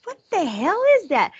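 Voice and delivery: high startled what the hell. Retold or imitated. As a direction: react to a strange noise in the night. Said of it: "I said".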